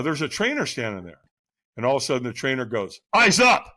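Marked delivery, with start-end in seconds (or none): 3.1-3.7 loud, high-pitched bark; trainer's command. Retold or imitated. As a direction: snap an order like a firewalk trainer. Retold trainer barks command.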